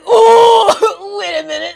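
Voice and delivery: loud high startled cry through laughter; shocked yelp. Retold or imitated. Shocked as bra taken off.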